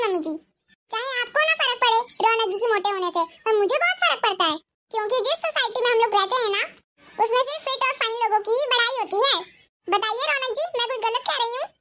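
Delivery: a bossy bahu telling off her mother-in-law; shrill daughter-in-law. Acted. Ekta lectures mother-in-law. (सास को डाँटती तेज़ बहू, ऊँची आवाज़ में)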